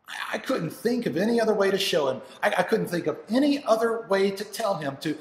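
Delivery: loud, high, desperate insistence; worked-up, stumbling outpouring of distress; anguished father. Acted. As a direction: plead that there was no other way. Father's anguished self-justification.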